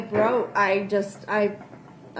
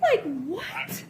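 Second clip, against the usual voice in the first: disbelieving laugh; 5 semitones higher, 5 dB quieter than usual. Real, not acted.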